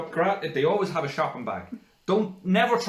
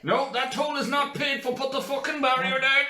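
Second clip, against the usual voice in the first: high, urgent pleading commands; desperate mock panic; 10 semitones higher than usual. Ordering the body shut as thunder comes, high panic. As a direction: plead frantically, pitch climbing with each order.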